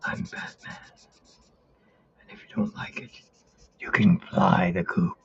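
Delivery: hushed, whispery superhero growl; Batman. Acted. Batman warning anyone who dislikes him, hushed strained voice. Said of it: "the other one was Batman".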